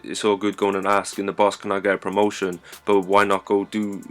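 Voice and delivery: ordinary talk, nothing marked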